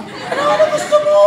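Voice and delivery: what on earth do you want, laughing; exasperated laughing shout. Real, not acted. Pastor to picky churchgoers, high laughing exasperated cry.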